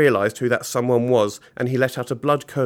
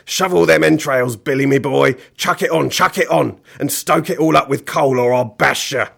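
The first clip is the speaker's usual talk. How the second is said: bossy villain; 3 semitones higher, 6 dB louder than usual. Acted. Mister Gum driving Billy to shovel, bossy shouting. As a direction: urge him on with shouted threats.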